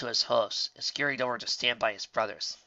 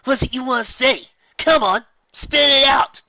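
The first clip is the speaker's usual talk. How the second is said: taunting shout; 14 semitones higher, 13 dB louder than usual. Acted. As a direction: goad them to answer. Bully goading silent fillies.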